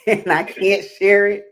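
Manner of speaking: laughing through a bright refusal. Real, not acted. Laughing as she refuses to show the card to her co-host.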